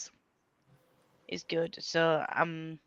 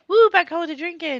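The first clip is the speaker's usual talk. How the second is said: excited cheer; 10 semitones higher, 7 dB louder than usual. Retold or imitated. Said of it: "I was like".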